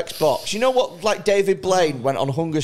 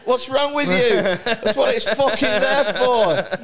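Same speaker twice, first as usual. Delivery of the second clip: higher, hammed-up indignant scolding; indignant rant; 7 semitones higher, slower than usual. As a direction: play the caged man outraged at the heckler. Caged man scolding the passer-by.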